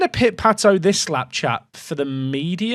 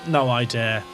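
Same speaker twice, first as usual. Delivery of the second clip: normal talking voice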